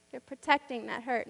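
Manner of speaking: normal talking voice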